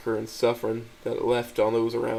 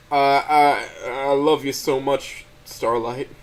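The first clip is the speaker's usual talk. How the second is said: tearful; 2 semitones higher, 7 dB louder, much slower than usual. A narrator's reading. Narrator's tearful confession.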